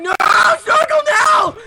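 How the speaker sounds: very high, loud desperate cry; anguished shout. Acted. Kurt yelling at Snorkel to stop, very high. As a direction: scream the plea. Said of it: "And Kurt was like".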